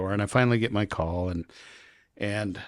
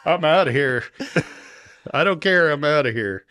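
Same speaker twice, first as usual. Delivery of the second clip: louder, higher, laughing relief; gleeful relief; 7 semitones higher, 6 dB louder than usual. Retold or imitated. Traveler escaping the officer, loud laughing relief. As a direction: bolt for the exit happily. Relief after passport stamped.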